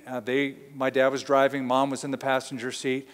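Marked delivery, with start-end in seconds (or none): none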